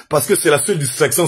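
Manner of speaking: normal talking voice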